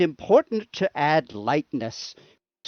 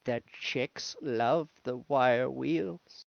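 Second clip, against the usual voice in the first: slow, softer knowing tone; a hint of a smug lowered aside; sly aside; 4 semitones lower, 9 dB quieter, slower than usual. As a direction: purr it like a secret.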